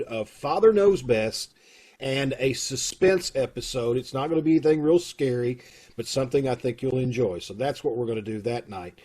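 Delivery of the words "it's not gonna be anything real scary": usual speaking voice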